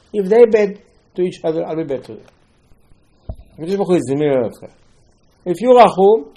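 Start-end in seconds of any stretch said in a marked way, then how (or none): none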